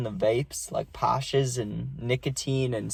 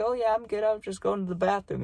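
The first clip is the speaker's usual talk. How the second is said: higher, politely composed grown-up voice; stoned teen answering his friend's mom, in a higher, proper parent voice; 8 semitones higher, 4 dB quieter than usual. Retold or imitated. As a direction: sound sober and proper for the adult. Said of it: "put on my parent voice".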